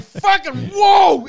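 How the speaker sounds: slow, loud mock whoa of horror; shocked shout. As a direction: recoil at the ex in shock. His shocked reaction, acted.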